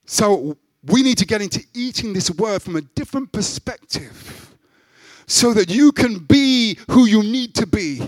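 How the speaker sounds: loud, high, impassioned sermon; preaching. Acted. Preacher urging the church, loud and high. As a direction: preach it with full force to the congregation.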